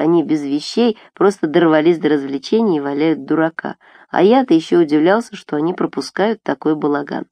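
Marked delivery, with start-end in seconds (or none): none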